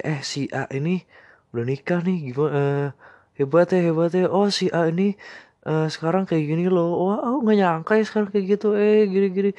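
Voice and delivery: high, gushing gossip voice; gossipy friends. Retold or imitated. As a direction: gush over the news, high.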